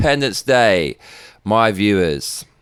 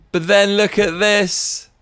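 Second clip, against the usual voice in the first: high, slow emphatic setup; pitched-up sarcastic look-here; incredulous; 9 semitones higher, slower than usual. Real, not acted. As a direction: draw out the reveal. Pointing out hypocrisy.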